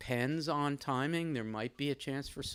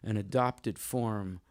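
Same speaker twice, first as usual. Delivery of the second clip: formal literary reading; 6 dB louder than usual. Real, not acted. Host reading a review to introduce the poet, formal emphatic reading. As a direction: read it out deliberately, with weighted key words and pauses.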